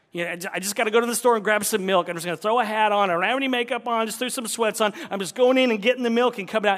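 fast, high and loud casual rambling; breezy harried errand voice; hurried shopper monologue. Pretend. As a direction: play someone rushing to grab milk.

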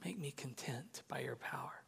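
very soft, almost whispered prayer; hushed prayer. Acted. Preacher modelling a willing prayer to the Holy Spirit, hushed. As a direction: pray it barely aloud, low and hushed. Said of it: "I'm gonna pray this".

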